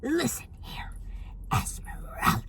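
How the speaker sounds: breath-only, menacing address; whispered threat. Acted. Vlogger warning the downstairs neighbor, whispered threat.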